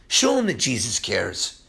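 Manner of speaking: ordinary delivery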